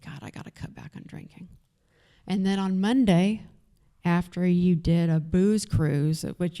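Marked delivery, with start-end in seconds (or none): 0.0-1.5 fast, voiceless mutter to herself; whispered regret. Retold or imitated. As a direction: whisper it like a hungover vow. Groggy morning self-talk quoted.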